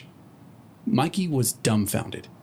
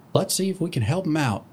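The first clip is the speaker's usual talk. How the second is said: pony voice; 6 semitones higher than usual. Acted. Third pony voice.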